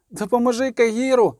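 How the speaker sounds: urgent high plea for help; high cry. A narrator's reading. Girl begging the witcher to help Kahir, high cry. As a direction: cry out, pitched well up. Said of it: "крикнула Ангулема".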